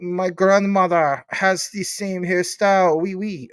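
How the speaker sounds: hammed-up French accent; put-on French accent. Joking about grandmother's hairstyle, mock French accent.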